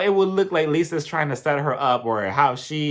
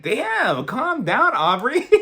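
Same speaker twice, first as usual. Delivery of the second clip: high incredulous calm down; startled pushback, pitched high; mock alarm; 8 semitones higher, 4 dB louder, much slower than usual. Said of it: "And I'm like".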